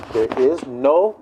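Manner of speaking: "There is no" slow, each word separate; emphatic and clear. Shown as a demonstration. Preacher making sure people hear, slow clear "no". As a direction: spell out "There is no" deliberately. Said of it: "say it good and clear".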